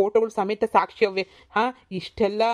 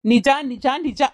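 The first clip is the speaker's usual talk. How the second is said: louder, emphatic insistence; repeated loud protest; insisting loudly; 5 semitones higher, 5 dB louder, much slower than usual. Acted. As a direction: hammer home that it's true. Son insisting to mother.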